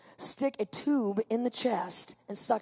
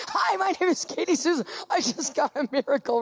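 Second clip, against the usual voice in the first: high-pitched, gleeful introduction; hammed-up delight, like a repeated routine; excited greeting; 3 semitones higher than usual. Patient greeting the next person in, excited. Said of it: "I do this".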